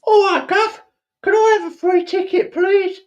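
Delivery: very high, loud squeaky Cockney beggar; mock Cockney urchin. Urchin begging the governor for a free ticket, squeaky Cockney. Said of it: "I heard your Cockney accent".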